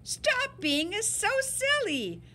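ordinary delivery, nothing marked

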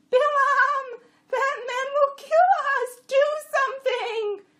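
very high, loud donkey panic; scream-bray for help at top pitch; frantic bray. A narrator's reading. Jenny warning her rider of the swordsman, frantic bray. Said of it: "she brayed and stopped".

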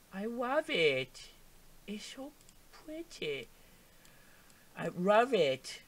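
slow, stretched-out adoring coo; put-on cooing voice. Player gushing over the look, slow cooing. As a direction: draw each word out in a put-on voice.

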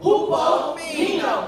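very slow, loud "Hupomeno"; call-and-response lead. Shown as a demonstration. Preacher retries with congregation not in one accord, drawn-out loud lead. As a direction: stretch each syllable of "Hupomeno" so all join. Repeating for congregation to join in unison.

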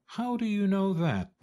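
high, eager fox question; fox asking. A narrator's reading. Fox questions Ducky Lucky.

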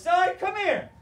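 high, loud summons from grandma; stern grandma. Retold or imitated. Grandma calls the grandson in, high and loud. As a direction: call him over like a grandma who means business. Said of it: "And grandma says".

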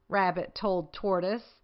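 usual speaking voice

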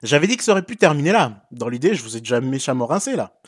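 usual speaking voice

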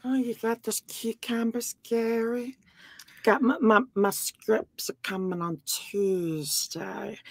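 soft, halting voice; hushed impression of someone's mom; Tanya. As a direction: mumble it slowly, trailing into a whisper. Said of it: "Amber's mom, Tanya".

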